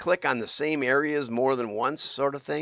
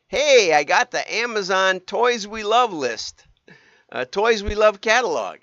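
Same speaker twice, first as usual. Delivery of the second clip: higher, louder, excited retelling of her call; excited style consultant; 8 semitones higher, 4 dB louder than usual. Retold or imitated. Consultant phoning him about the toys catalog, excited. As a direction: imitate her bubbling over with news on the phone. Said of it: "she was all excited".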